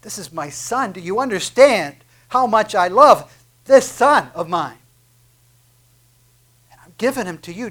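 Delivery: a father offering his only son; lightly voiced God the Father. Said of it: "picture of God going".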